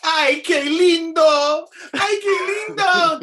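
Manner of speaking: high, loud cheek-pinching coo; doting abuela. Retold or imitated. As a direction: gush it slowly like a Cuban grandma. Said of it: "my friend's abuela used to say".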